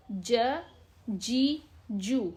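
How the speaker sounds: "ج", "جی", "جو" as ja, jee, joo; unspelled syllable drill. Shown as a demonstration. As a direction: drill "ج، جی، جو" with a pause after each. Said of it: "جوڑ کر کے پڑھنے کی ضرورت نہیں ہے".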